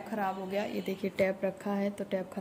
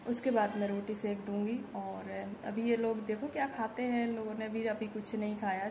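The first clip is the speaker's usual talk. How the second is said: ordinary talk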